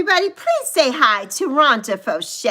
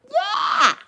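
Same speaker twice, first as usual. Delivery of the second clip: much higher cry; excited yeah. Real, not acted. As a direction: shout yeah with delight. Recalling the cruise.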